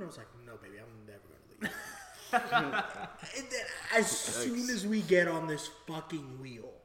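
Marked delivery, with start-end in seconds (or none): 0.4-1.7 low, very soft, quick promise; tender reassurance. Retold or imitated. Storyteller soothing his pleading girlfriend, low and tender. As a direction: melt into a hushed retold promise.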